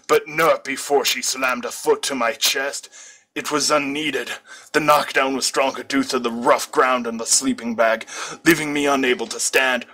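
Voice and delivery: usual speaking voice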